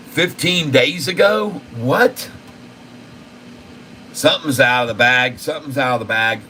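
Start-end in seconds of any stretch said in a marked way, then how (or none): 0.1-2.3 a sudden high "What?" of real surprise; shocked disbelief. Real, not acted. Streamer reacting to old news on a stock, shocked and high. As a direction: pitch jumps much higher on the question, stunned.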